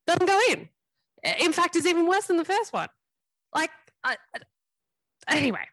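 exasperated. Real, not acted. Exasperated at missed penalty.

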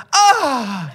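high, sharp "ah" of pain; hammed-up yelp; imitated pained cry. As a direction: reenact yelping at a sudden knock. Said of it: "yo hago".

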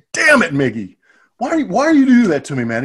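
high, loud half-laughing complaint; laughing exasperation. Retold or imitated. Scolding Miggy for making him laugh, high and loud. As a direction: protest while cracking up. Cracking up at teammate's joke.

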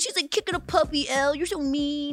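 high, whiny put-on voice mocking the fans; teasing co-host in a high mock-sympathetic voice; mocking sympathizer voice. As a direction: squeak it out like a pitying busybody. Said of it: "why are you talking in that accent?".

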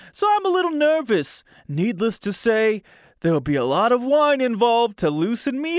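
high, hammed-up New Jersey guy; mock Jersey lover gushing about his ghost; put-on New Jersey accent. Host imitating the ghost's boyfriend for listeners, hammed-up New Jersey accent. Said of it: "I can't talk like that anymore".

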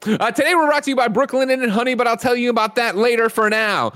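high, bright sponsor announcement; announcer read. Acted. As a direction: pitch the sponsors like a radio host.

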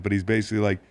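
ordinary talk

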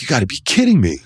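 high-pitched retold disbelief; mock-astonished protest; incredulous. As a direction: act out being dumbfounded by the news. Retelling his reaction to blading ban.

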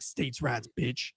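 low, soft retold defiance; mock muttered taunt; low defiant mutter. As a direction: drop it down low and quiet, unbothered. Suspect stares down the gun barrel.

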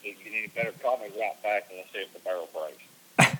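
muffled phone voice from a barrel; inside a barrel. Real, not acted. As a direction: sounds like talking inside a barrel. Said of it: "It's kinda muffled".

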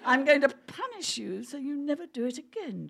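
ordinary delivery, nothing marked

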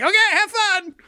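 very high, thin chirpy goodbye; creaky mannequin voice. Acted. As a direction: wave them off in a squeaky puppet voice. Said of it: "just being like".

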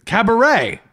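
"cabaret" with the final "ray" punched; insistent caba-RAY; stress on last syllable. Shown as a demonstration. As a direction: land hard on the end of "cabaret". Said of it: "This is just an emphasis thing".

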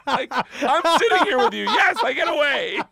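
loud, high, laughing exasperation; exasperated mock yell. Dad answering his clueless daughter, exasperated mock yell. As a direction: yell the obvious in comic disbelief.

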